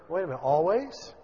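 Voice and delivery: ordinary delivery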